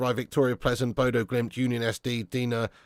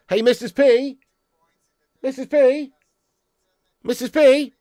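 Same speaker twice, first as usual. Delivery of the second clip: high, louder calling out to a friend; sing-song greeting; 11 semitones higher, 6 dB louder than usual. Real, not acted. Streamer greeting a regular viewer, high sing-song call. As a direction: call her name out gleefully.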